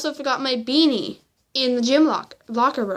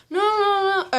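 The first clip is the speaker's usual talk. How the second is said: higher-pitched mock denial; retold denial; 8 semitones higher than usual. Classmate answering the teacher about her hand, mock denial. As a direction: mimic someone hurriedly denying blame.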